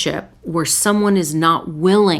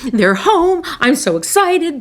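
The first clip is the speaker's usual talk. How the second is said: much higher, bouncy puppy voice of pure joy; overexcited dog; 9 semitones higher than usual. Pretend. Playing the family dog greeting its owner at the door, squealing with excitement. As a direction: voice the dog bursting with glee as you walk in. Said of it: "the dog is like".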